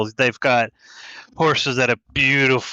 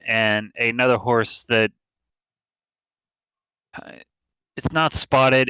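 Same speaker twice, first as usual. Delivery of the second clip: normal talking voice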